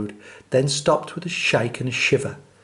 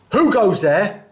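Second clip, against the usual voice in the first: louder, higher challenge; crying out; 6 semitones higher, 6 dB louder than usual. Acted. Rat challenging the rabbit on the road, loud. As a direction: call it out like a bandit. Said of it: "who cried out".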